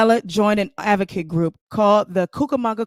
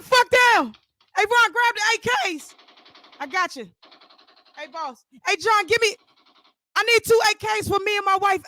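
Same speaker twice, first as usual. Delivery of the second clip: very high, frantic screaming; panicked yelling; 12 semitones higher than usual. Acted. Under gunfire he shrieks at his wife and friends to get out, frantic.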